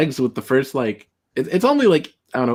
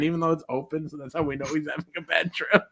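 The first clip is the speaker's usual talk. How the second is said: ordinary delivery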